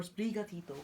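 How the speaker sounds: Sprigatito in a Spanish accent; Spanish-accented pronunciation of Sprigatito; accented name. Real, not acted. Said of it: "the reason I say it like that".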